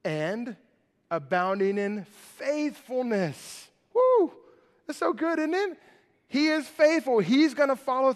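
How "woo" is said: high, loud "woo!"; short whoop of joy, pitched way up; delighted whoop. Real, not acted. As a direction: let out a high celebratory whoop. Preacher's exclamation of delight.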